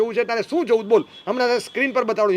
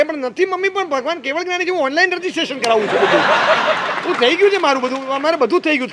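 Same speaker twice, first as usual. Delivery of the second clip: cheeky son; 3 semitones higher than usual. Retold or imitated. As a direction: brush off mother with a laugh. Son answering mother.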